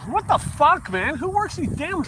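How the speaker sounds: high, exasperated swearing; startled outburst. Real, not acted. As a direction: splutter as you get soaked. Splattered again by sprinklers.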